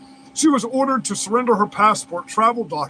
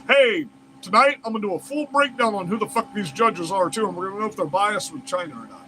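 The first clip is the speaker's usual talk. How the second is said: usual speaking voice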